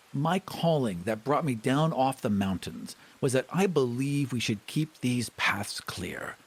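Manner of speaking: normal talking voice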